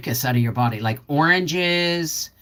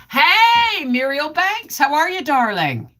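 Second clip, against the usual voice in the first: much higher and louder bright hello; cheery greeting; 11 semitones higher, 4 dB louder, slower than usual. Real, not acted. Greeting viewer Muriel Banks, a high cheery hello. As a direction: sing out the hello to a friend.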